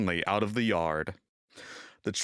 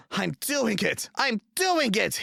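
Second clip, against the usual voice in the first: higher, effortful panting triumph; 13 semitones higher, 3 dB louder than usual. A narrator's reading. James halfway up the hill, panting. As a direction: gasp it out uphill. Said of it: "he panted".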